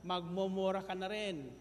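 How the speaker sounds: normal talking voice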